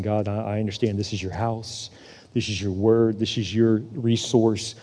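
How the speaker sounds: low, soft, humble address; hushed prayer. Acted. Believer yielding house and resources to God, low hushed prayer. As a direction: speak it quietly, as if praying.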